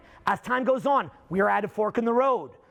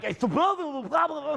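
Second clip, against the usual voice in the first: mock wordy babble; 3 semitones higher than usual. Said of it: "my catchphrase is a little more wordy".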